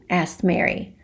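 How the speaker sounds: usual speaking voice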